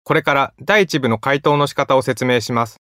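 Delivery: usual speaking voice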